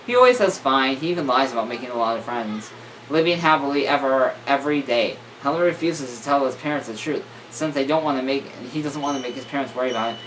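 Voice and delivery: ordinary delivery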